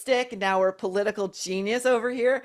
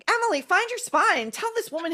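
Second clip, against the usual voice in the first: much higher, pleading exasperation; exasperated, pitched way up; exasperated plea; 11 semitones higher than usual. Real, not acted. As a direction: urge her on, voice climbing high.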